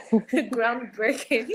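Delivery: laughing through the word slowly; giggling while praising. Real, not acted.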